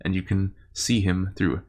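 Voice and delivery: game master narration. Acted.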